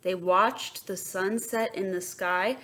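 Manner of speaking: ordinary delivery, nothing marked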